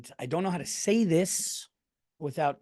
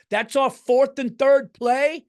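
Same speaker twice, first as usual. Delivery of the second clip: much higher, louder and slow, hammed-up proud dad; imitating Tommy Cutlets' dad; 10 semitones higher, 9 dB louder, slower than usual. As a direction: belt it like a thrilled dad in the stands. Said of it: "I will see Tommy Cutlet's dad go".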